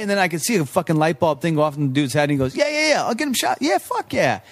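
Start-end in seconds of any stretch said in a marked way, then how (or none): 2.6-4.4 high, fast mock-eager "yeah yeah"; eager bartender. Mimicking the scheming bartender to friends, high and eager. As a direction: ham up the fake friendliness.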